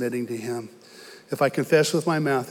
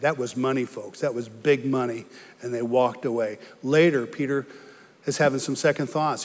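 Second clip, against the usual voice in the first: usual speaking voice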